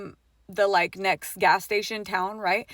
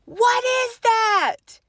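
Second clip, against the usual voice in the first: loud, high, drawn-out shriek; terrified scream imitation; screamed alarm; 13 semitones higher, 7 dB louder, much slower than usual. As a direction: shriek it in sudden terror, stretching the words.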